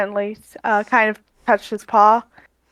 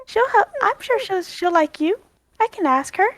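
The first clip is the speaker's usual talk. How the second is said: raised, coaxing voice; gentle Aria; 9 semitones higher than usual. Acted. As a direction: coax a shy animal kindly. Aria coaxes the monkey.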